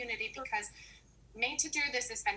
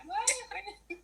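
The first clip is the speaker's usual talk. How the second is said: surprised what; 12 semitones higher than usual. Retold or imitated. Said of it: "'cause I was like".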